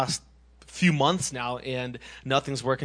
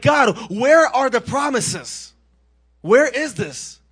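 much higher and louder, slow pleading yell; yelling at God; 10 semitones higher, 8 dB louder, slower than usual. Retold or imitated. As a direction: shout your complaint up at the sky. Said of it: "I'm yelling, and I'm upset".

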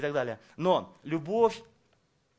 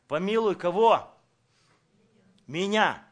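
"Меня" singled out and hit louder; stress on Меня; 3 semitones higher, 6 dB louder than usual. Shown as a demonstration. Preacher pointing out the word the woman cried, "Меня" stressed. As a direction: quote "Помилуй", then punch "Меня". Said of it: "А почему меня-то она кричит?".

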